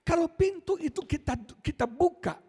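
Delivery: high, loud, impassioned preacher voice; full-force preaching. Acted. Preacher to congregation, high and loud, impassioned. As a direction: drive the story home to the crowd, loud and high.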